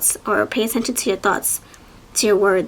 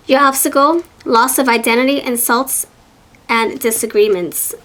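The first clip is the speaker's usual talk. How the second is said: normal talking voice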